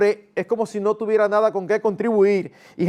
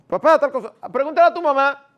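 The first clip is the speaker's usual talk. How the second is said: lightly voiced pleading kid, pitched higher and louder; child voice; 8 semitones higher, 5 dB louder, faster than usual. A child asks his father for permission, raised pleading child voice. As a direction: play the child begging his dad, a bit raised.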